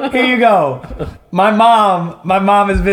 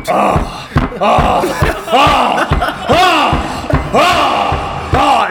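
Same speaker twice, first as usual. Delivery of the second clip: mock moaning; 8 semitones higher, much slower than usual. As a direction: ham up the sex noises through the wall.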